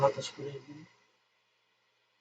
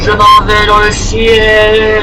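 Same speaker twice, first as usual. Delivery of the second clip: drawn-out, louder, very slow; slow mock farewell; 5 dB louder, much slower than usual. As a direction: stretch it like drifting up to the sky. Character dies, mock farewell.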